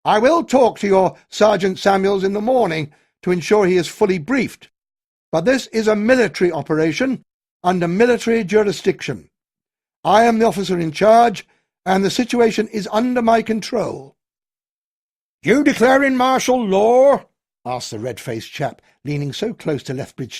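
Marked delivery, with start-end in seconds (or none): 0.0-14.2 loud, higher firm pronouncement; commanding officer. A narrator's reading. Colonel tells the villagers he is in charge, loud and firm. Colonel asserting military control. 15.4-17.3 loud, high incredulous question; challenging local. A narrator's reading. Red-faced drinker challenges the colonel, loud and incredulous.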